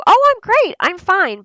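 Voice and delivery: very high, loud chirpy reply; hammed-up quick cheeriness; overly cheerful brush-off. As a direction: insist brightly that all is well.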